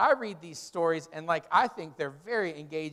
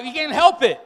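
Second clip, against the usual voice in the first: exasperated choking victim; 9 semitones higher, 12 dB louder than usual. Retold or imitated. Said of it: "we were like".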